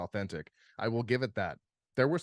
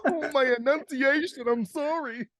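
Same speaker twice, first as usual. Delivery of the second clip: laughing; 4 dB louder than usual. Real, not acted. Laughing after flub.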